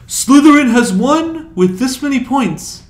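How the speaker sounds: pompous imitated headmaster, high and loud; hammed-up Dumbledore. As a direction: ham up Dumbledore's announcement to the hall. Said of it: "he's like".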